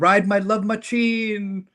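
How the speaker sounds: slow, hammed-up bad Jamaican accent. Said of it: "does a bad Jamaican accent".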